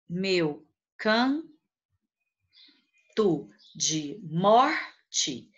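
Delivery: "meu canto de morte" slowly broken into single syllables; "meu canto de morte" counted out as meu, can, to, de, mor, te. Shown as a demonstration. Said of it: "em sílabas poéticas".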